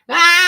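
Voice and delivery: sudden very high, loud scream; mock scream. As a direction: shriek in mock panic. Imitating screaming.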